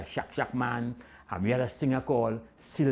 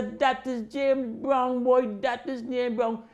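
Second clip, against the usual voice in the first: excited cheer; 12 semitones higher, slower than usual. Retold or imitated. Crowd cheering again.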